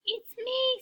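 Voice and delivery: very high squeaky falsetto; tiny high-pitched imitation; squeaked. A narrator's reading. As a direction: squeak it like a cat faking a girl. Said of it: "squeaked the cat".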